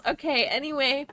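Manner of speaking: usual speaking voice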